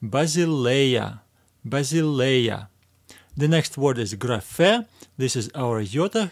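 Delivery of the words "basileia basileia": slow, careful Basileia, syllable by syllable; reading together. Shown as a demonstration. Teacher reading the word with learners, slow and careful. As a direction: draw out Basileia slowly for learners to follow. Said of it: "Let's read it together".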